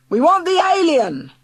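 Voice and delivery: high, loud chant of a mob; yelling crowd. Acted. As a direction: yell it as the mob. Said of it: "they yelled".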